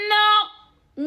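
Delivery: loud, high, sudden no; sharp refusal. Retold or imitated. Refusing the stranger her number, a loud high no. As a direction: shut it down hard.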